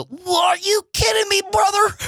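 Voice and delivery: mock shout. Retelling his outburst after sex, high hammed-up shout. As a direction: yell it at the ceiling in disbelief. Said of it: "And I go".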